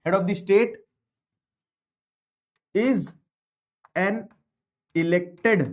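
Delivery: word by word with long pauses; measured classroom dictation, each word separate; slow dictation. Real, not acted. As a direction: dictate slowly, leaving time to write. Dictating a definition to students.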